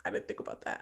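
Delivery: put-on voiceless whisper of a little boy; imitating a small child. Son answering his mother, imitated in a whisper. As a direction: whisper it like a shy kid. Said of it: "And he's like".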